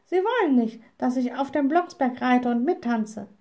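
high-pitched, sulking little witch; cross little witch. A narrator's reading. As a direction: complain as a lightly voiced young witch.

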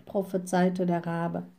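ordinary delivery, nothing marked

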